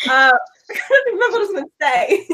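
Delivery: giggling through the words, high and fast; can't stop laughing mid-sentence. Real, not acted. Friend laughs at her own glitchy audio, words breaking up. Laughing fit after joke.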